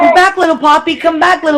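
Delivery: high, playful plea; high sing-out, half joking; mock calling after someone. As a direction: call back someone small. Mock calling little Poppy.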